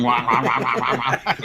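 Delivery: droning wah wah sound; fridge hum imitation.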